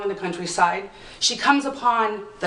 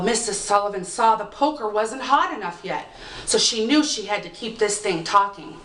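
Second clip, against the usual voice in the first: plain reading voice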